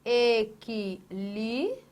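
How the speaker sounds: "equilíbrio" broken slowly into syllables. Shown as a demonstration. Teacher splits the word for students, syllable by syllable. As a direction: stretch out each syllable of "equilíbrio", pausing between. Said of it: "Você tem aqui, ó".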